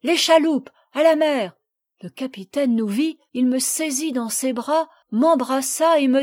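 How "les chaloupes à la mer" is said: raised, higher, urgent call; cried-out ship's command; shouted order. A narrator's reading. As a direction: call it out loud across a deck. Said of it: "J'entendis qu'on criait".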